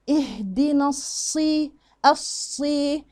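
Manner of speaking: slow recitation, heavy held "ص" in "الصي"; light "نا", then a thick, long "الصي"; emphatic saad. Shown as a demonstration. Teacher demonstrates the saad for learners, slow and heavy. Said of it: "بالنسبة للصاد".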